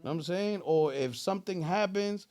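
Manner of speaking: usual speaking voice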